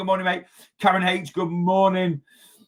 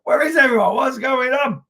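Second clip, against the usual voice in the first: higher, louder mock puzzlement; where is everyone, asked higher in mock alarm; mock bewildered; 10 semitones higher, 5 dB louder than usual. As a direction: play up being baffled by the empty live. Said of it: "I was like".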